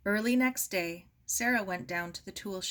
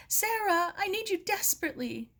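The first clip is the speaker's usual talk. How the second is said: much higher calling voice, fast; a girl calling her sister, higher and hurried; imagined call; 12 semitones higher, 3 dB louder than usual. A narrator's reading.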